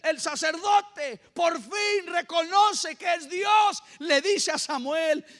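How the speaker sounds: high, slow, full-force preaching; prédica enérgica. Real, not acted. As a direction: proclaim it in amazement, stretching every phrase. (predicación a toda voz, aguda y pausada)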